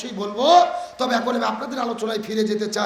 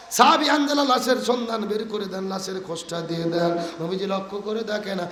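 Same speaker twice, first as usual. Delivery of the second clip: ordinary talk, nothing marked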